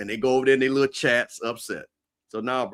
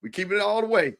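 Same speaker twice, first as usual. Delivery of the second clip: excited; 7 semitones higher, 4 dB louder, faster than usual. Real, not acted. Hyped rallying for Atlanta.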